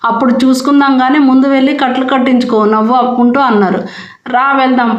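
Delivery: ordinary delivery, nothing marked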